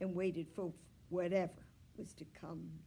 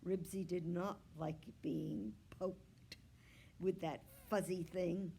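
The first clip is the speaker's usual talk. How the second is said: plain reading voice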